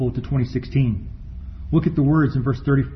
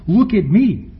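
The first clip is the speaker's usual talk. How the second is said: mock boast; 5 semitones higher, 5 dB louder, slower than usual. Mocking proud hypocrites.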